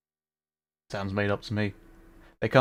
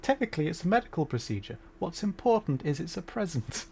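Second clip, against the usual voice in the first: lightly mocking echo, higher and softer; mimicking game character; 6 semitones higher, 5 dB quieter than usual. Streamer echoing the game's excuse about a birthday present, light mimicry. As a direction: repeat it in a soft, raised voice.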